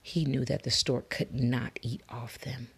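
usual speaking voice

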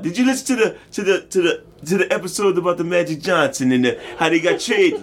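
high, laughing mock French accent. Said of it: "I keep going with it now".

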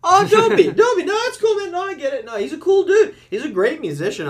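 very high, loud, laughing protest; high-pitched gushing reassurance, easing down at the end; emphatic reassurance. Real, not acted. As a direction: wave away the guest's embarrassment at full volume. Host reassures embarrassed guest.

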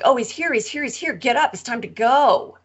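high, urgent call to wake the sleepers; excited wake-up call. Retold or imitated. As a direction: rouse everyone, he's arrived.

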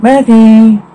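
acted searching call. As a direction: call the name into the distance. Searching for missing Maggie.

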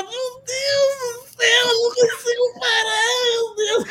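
high, giggling can't-stop panic; laughing fit. Retold or imitated. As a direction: squeal it through helpless laughter. (esposa chapada rindo sem parar, voz aguda)

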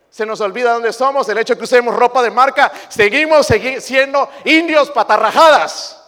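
loud, high rising sermon cry; fiery preacher hammering the point; preaching at full force. Acted. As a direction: build it up to a shout. Preaching climax.

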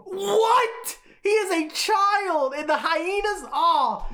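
high, loud, appalled outburst; outraged disbelief. Acted. Fan reacting to Scar's order on a child, shrieked outrage.

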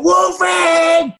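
a high, carrying call across the street; taxi driver shouting. Retold or imitated. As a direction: yell the name from a cab window. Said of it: "a taxi driver shouted out".

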